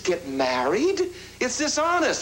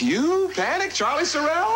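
incredulous; 8 semitones higher than usual. Acted. George disbelieves Charlie panicking.

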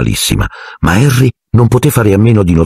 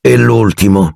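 ordinary delivery

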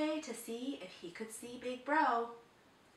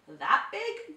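gulping; 6 semitones higher, 8 dB louder than usual. A narrator's reading. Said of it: "gulped the elephant".

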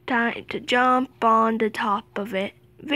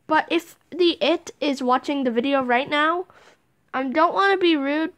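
ordinary talk

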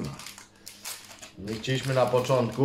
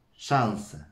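ordinary talk, nothing marked

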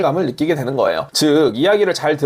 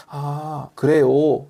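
acted indifferent brush-off; unimpressed response; 2 semitones lower, much slower than usual. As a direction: answer with complete lack of interest. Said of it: "시큰둥하게 반응한다면".

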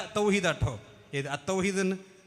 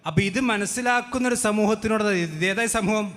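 ordinary talk, nothing marked

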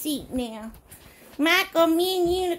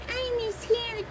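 high, softer mock-sad voice; a small high pretend whine; crying lion; 8 semitones higher, 5 dB quieter than usual. Little lion missing Hannah, high soft pretend crying. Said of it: "Lion go".

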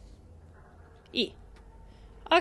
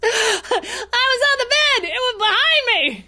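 high laughing words after a fright; laughing while talking; 7 semitones higher than usual. Real, not acted. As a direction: laugh through the line, still shaken. Laughing at own jump scare.

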